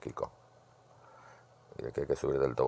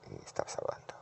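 whisper; 10 dB quieter, faster than usual. Real, not acted. People entering, whispers aside.

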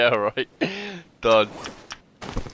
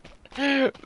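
high giggle; 4 dB louder than usual. Real, not acted. Laughs at the hat.

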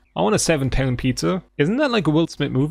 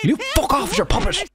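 high, strained cartoonish puppet voice; nostalgia critic voice. Gamer mocks the puppet prankster in a high silly voice. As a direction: spit it out in a goofy critic impression. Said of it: "This fucking dumb nostalgia critic voice".

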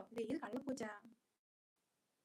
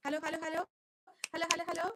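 loud, higher, rapid-fire hellos; sound-check calling; 5 semitones higher, 6 dB louder, much faster than usual. Real, not acted. Streamer testing sound for viewers, loud insistent calling. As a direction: call out to test the line.

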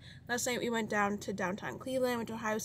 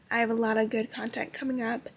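ordinary talk

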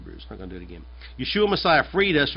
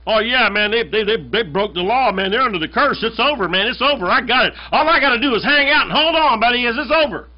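higher, louder smug devil sure he's won; gloating devil; 7 semitones higher, 3 dB louder than usual. Acted. Preacher voicing the devil gloating over the curse, smug. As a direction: crow like a devil who thinks he's won. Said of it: "the devil thought he had this in the bag".

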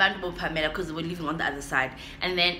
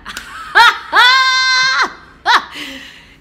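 big shrieking burst of laughter, much higher and louder; 12 dB louder than usual. Real, not acted. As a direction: let out a huge real laugh. Laughing at her own story.

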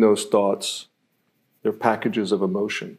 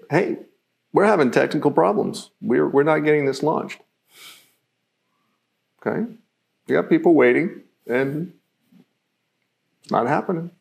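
tense, higher, hurried worry; stressed tone; 5 semitones higher than usual. Shown as a demonstration. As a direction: sound like the launch is failing. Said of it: "You notice the tone, the cadence".

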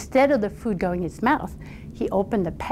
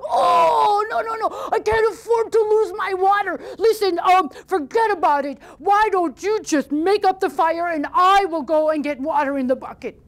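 panicked troll; 9 semitones higher than usual. Acted. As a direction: blurt out no no no in a panic. Troll panics about water.

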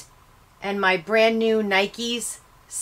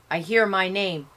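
plain reading voice

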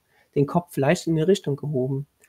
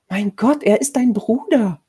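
high, loud, horrified appeal; appalled protest; 7 semitones higher, 5 dB louder than usual. A narrator's reading. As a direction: plead with outrage. Woman appalled at boy's violence. (entsetzter Vorwurf)

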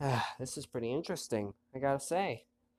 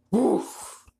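mock bark; 11 semitones higher, 7 dB louder than usual. Dog's bark in game text.

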